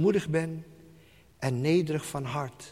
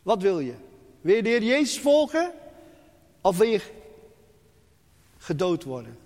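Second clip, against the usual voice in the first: persecutors' threat; 5 semitones higher, 5 dB louder, faster than usual. Retold or imitated. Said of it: "en zeggen".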